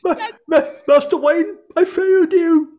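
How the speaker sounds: loud, high, hammed-up butler impression; mock anguished apology. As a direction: wail it in a broken-up comic imitation. Acting out butler's movie apology.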